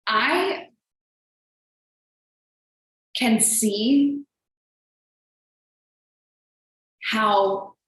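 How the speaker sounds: halting words, choking up between pauses; fighting back real tears, one word at a time; getting emotional. Real, not acted. Talking to her community, voice catching with emotion. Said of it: "I'm getting emotional thinking about it".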